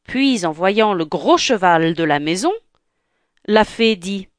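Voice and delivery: ordinary delivery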